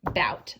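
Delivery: "Bout" blended from b and out; blending syllable. Shown as a demonstration. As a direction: say "Bout" as one smooth blend. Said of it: "B, out".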